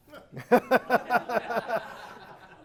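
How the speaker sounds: loud, high burst of laughter; hearty laugh. Real, not acted. Speaker telling the audience a funny memory, laughing loudly. Big laugh at own story.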